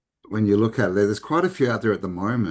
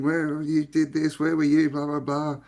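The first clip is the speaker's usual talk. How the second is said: retold yelling; 7 semitones higher than usual. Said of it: "she starts yelling at him".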